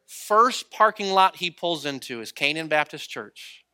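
usual speaking voice

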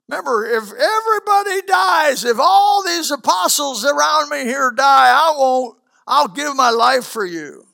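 high, loud, defiant vow; boastful shout. Acted. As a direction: shout your loyalty proudly.

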